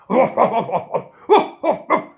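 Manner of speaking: loud, hammed-up belly laugh; big gloating cartoon laugh; mocking dog laugh. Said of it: "the dog laughed at him".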